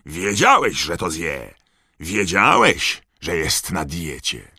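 furious father scolding; thundering accusation. A narrator's reading. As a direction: hammer the accusation, then drop back. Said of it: "zagrzmiał pan Weasley".

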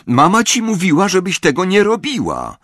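high, loud telling-off; scolding child. A narrator's reading. Sister scolds Lili for jumping.

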